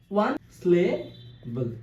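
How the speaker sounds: ওয়ান স্লেবল slow, split ওয়ান, স্লে, বল; measured, separated beats on ওয়ান স্লেবল; syllable by syllable. Shown as a demonstration. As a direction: pause between each part of ওয়ান স্লেবল. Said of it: "ভাগ ভাগ করে উচ্চারণ".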